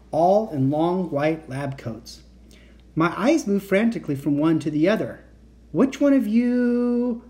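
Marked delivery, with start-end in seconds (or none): 5.7-7.2 high, drawn-out anxious question; trembling cry. A narrator's reading. Kat facing a roomful of Victors, trembling cry. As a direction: cry it out slowly, pitched up, with mild fear. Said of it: "I cried in a trembling voice".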